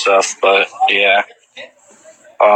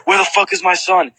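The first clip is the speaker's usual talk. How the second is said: a hint of a frantic stepdad, pitched higher; angry stepdad; 8 semitones higher than usual. Stepdad arriving at the scene asking for his son, lightly voiced frantic anger. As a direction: demand where the son is, mildly voiced. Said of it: "his stepdad's like".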